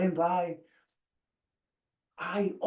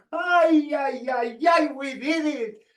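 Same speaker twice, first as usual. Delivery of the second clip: joyful cheer; 5 semitones higher than usual. Retold or imitated. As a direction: whoop with joy for the family. Celebrating the visa with family.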